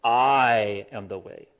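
slow "I am the way" with "I" weighted; stress on I. Shown as a demonstration. Preacher demonstrates the emphatic "I". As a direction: lean hard on "I", slowly. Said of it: "What Jesus is saying is".

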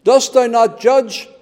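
high, loud pleading cry. Retold or imitated. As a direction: cry it out loud like martyrs calling up to God. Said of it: "with a loud voice".

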